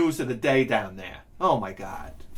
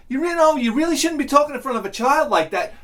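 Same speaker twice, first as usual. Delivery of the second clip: mock scolding; 11 semitones higher, faster than usual. Voices bystanders joining the scolding.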